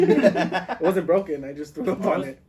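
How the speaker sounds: slow, laughing correction. Real, not acted. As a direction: laugh your way through the correction.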